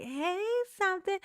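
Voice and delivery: high put-on greeting, rising; Alicia's funny voice. Imitating Alicia saying stuff to people, funny high voice. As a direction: lift it into a mock high voice. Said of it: "she got that funny voice".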